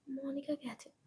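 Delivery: ordinary delivery, nothing marked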